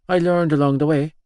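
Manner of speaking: ordinary delivery, nothing marked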